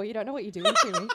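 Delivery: put-on swooning over the accent in jest; mock swoon. Laughing swoon at her friend's fake cockney.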